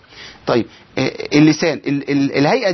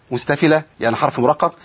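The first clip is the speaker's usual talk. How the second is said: usual speaking voice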